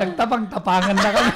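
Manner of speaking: talking loud through laughter; laughing. Real, not acted. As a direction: push the words out while laughing.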